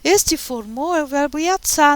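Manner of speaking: normal talking voice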